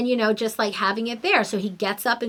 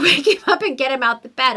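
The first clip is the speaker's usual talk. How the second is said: normal talking voice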